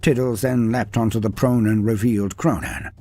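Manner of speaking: plain reading voice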